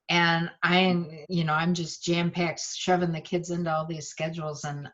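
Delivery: ordinary talk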